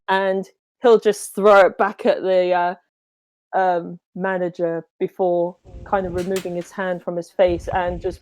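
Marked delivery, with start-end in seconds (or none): none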